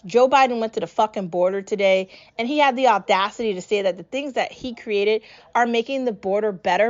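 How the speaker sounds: ordinary talk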